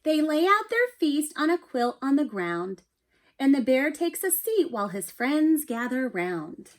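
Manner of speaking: animated picture book read-aloud; reading to children. A narrator's reading. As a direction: read it like bedtime story time for a class. Teacher reads picture book aloud.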